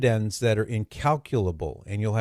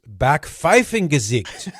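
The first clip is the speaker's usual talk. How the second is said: faux German accent; 4 semitones higher, 6 dB louder, slower than usual. Shown as a demonstration. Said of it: "With my faux German accent".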